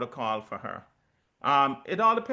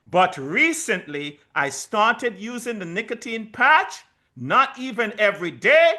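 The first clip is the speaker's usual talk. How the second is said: emphatic excited reread; 5 semitones higher, 7 dB louder than usual. Shown as a demonstration. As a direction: read it again, loud and proud for the saints. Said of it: "Come on, saints, listen to that".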